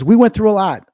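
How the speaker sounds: emphatic. Real, not acted. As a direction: insist we went through a lot, up high. Repeats with emphasis.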